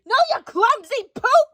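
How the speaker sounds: high, loud mock shout; hammed-up high shout. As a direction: yell it up high at the listener. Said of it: "you don't need to shout".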